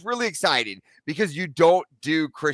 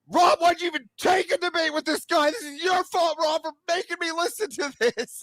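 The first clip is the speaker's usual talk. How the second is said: high, strained frustrated yelling at Rob; furious at the TV; angry outburst; 13 semitones higher than usual. Retold or imitated. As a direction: vent at Rob like you've lost it. Angry outburst at friend's debate.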